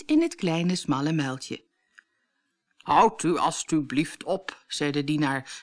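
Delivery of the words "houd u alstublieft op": slow, measured servant voice; formal servant. A narrator's reading. Prince's servant stopping the struggling women, formal. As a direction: object politely and slowly.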